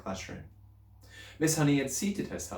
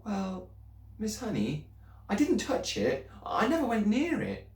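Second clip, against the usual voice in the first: put-on higher, anxious little girl's voice; girl pleading with her teacher, higher and anxious; young Matilda; 12 semitones higher than usual. A narrator's reading. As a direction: speak up as a worried schoolgirl.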